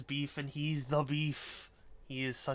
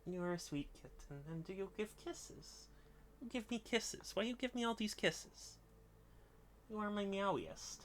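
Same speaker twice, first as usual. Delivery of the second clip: high, sweet cooing at a kitten; sing-song pet talk, pitched up; 6 semitones higher, 3 dB quieter than usual. Real, not acted.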